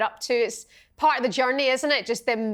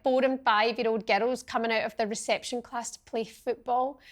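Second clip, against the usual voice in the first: ordinary talk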